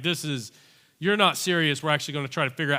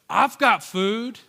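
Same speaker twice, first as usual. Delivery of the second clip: put-on slow, higher little kid offering; slow sweet kid; 5 semitones higher, 6 dB louder, much slower than usual. Boy offering his lunch to the adults, slow and sweet. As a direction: draw it out innocently like a seven-year-old. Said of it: "like a sweet little kid".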